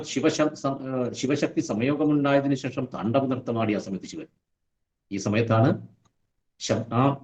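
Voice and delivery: ordinary delivery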